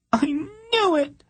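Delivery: very high, slow, sobbing wail. A narrator's reading. Princess wailing into Polgara's arms, sobbing. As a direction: let the tears break it. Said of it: "Tenedra wailed".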